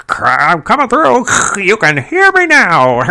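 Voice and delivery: high, loud, laughing mock radio transmission; mock walkie-talkie voice breaking through static; crackly radio voice. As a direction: squawk it like a crackling radio broadcast. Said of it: "radio broadcast crackling".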